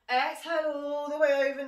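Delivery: loud cue. Real, not acted. Instructor cueing the stretch.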